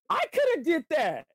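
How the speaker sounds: sudden high shout in an imitated voice; envious outburst. Imitating a jealous fan who shouts at a celebrity couple.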